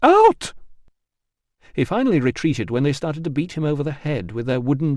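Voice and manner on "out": very high, loud scream; furious bathers screaming. Acted. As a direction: scream him out.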